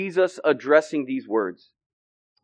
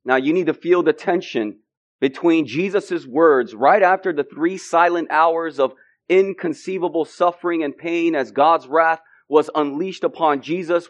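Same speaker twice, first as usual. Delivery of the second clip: ordinary delivery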